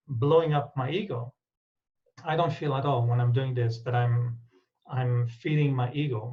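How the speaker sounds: ordinary talk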